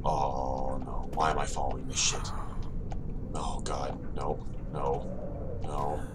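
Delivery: low hushed frightened whisper; terrified whisper. Real, not acted. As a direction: whisper in dread. Following a blood trail.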